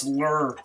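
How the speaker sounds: "lure" as one r-coloured syllable, "lurr"; corrected pronunciation. Shown as a demonstration. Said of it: "This is how they pronounce it".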